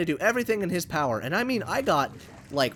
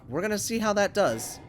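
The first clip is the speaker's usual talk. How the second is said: ordinary talk, nothing marked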